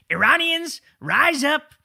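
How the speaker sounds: high, hammed-up rallying call; mock a big-talking president urging a revolt; mocking Trump. Host mocks Trump's post urging Iranians to rise up, hammed-up high voice. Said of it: "Trump goes out there on, on Lie Social and says".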